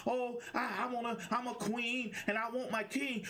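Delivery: preacher mocking women who talk about kings. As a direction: lampoon a woman who expects her king. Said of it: "all women talking about".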